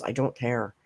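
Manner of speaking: ordinary talk, nothing marked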